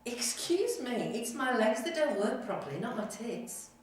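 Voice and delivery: high, loud, affronted comeback; indignant retort. Acted. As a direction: put the nurse in her place, loudly. Patient's acted indignant retort to nurse.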